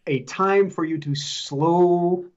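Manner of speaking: drawn-out, deliberately slowed words; a teasing slow-motion delivery for her. Shown as a demonstration. Host teasing friend to slow down, drawn out. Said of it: "I'm putting a turtle across the screen".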